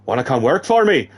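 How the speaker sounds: lightly faked Irish woman's voice; character voice in shaky Irish; put-on Irish accent. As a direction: try a confident Irish lilt. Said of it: "I've got that accent down now".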